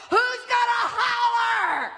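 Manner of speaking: high slow rallying shout; preaching shout. Real, not acted. Preacher urging the church to holler, slow high shout.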